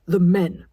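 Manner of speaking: sharp and loud, cutting her off; a sharp, loud correction; father sharply shutting down his daughter. A narrator's reading. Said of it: "Dad said sharply".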